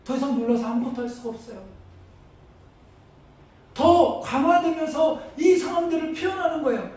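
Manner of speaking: preacher stirring his congregation; preaching at full force. Acted. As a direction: build the fear step by step.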